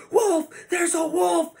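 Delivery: high, loud panicked shout of wolf; frantic yell. Retold or imitated. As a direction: scream it like the wolf is right there. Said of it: "frantically freaking out and going".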